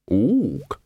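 ape ook. Acted. Librarian ape speaks.

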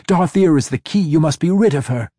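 high, loud urgent command. A narrator's reading. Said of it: "the urgency in Jindia's voice".

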